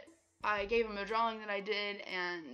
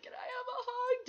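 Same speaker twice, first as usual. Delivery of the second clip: much higher, gushing fan voice; excited squeal. Retold or imitated. Fan asking the singer for a hug, squealing high.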